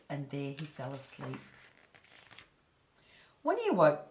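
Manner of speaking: ordinary delivery, nothing marked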